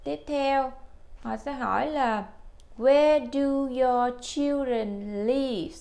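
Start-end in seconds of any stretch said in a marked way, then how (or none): 2.8-5.7 slow, clear model question. Shown as a demonstration.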